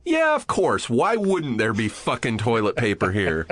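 high, sarcastic self-griping; acted-out fed-up sarcasm; sarcastic exasperation. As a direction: gripe aloud to yourself, jumping high on the first words. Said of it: "Audibly, I'll go".

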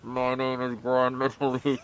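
slow, muffled masked wrestler voice; Grand Metalik voice. Retold or imitated. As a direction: speak slowly as if through a mask. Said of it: "What does Grand Metalik sound like again?".

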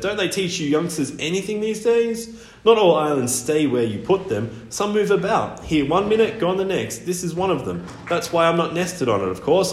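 high, impatient albatross voice; scolding bird. A narrator's reading. Albatross explaining moving islands to youngster, scolding.

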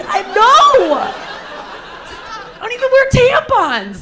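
hammed-up high wail of protest; mock-crying denial, loud then stretched out; tearful panic. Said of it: "I started crying instantly".

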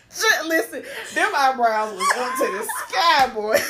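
half-shouting through laughter; loud, laughing exclamation. Real, not acted. Shouting through laughs while describing Chad's eyebrows.

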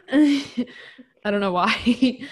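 slow words through laughter; laughing. Real, not acted. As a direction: laugh it out, drawn out. Laughing at her own burping.

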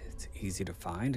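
usual speaking voice

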